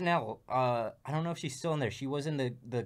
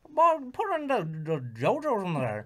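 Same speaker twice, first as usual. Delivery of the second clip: put-on high, loud bayou cook with a thick accent; thick Southern accent; 8 semitones higher, 5 dB louder than usual. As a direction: garble it like a Southern chef. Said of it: "a real thick accent or something".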